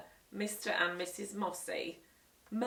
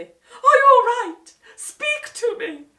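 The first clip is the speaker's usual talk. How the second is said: much higher, louder, slow panicked plea; alarmed wife; 17 dB louder, slower than usual. Acted. Wife over fallen husband, panicked high plea. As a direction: beg him to answer.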